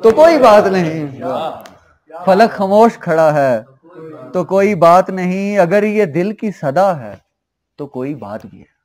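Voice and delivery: projected, ringing verse delivery; ghazal recitation. Acted. Poet repeating a couplet to a cheering audience, projected recitation. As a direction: declaim the couplet for applause.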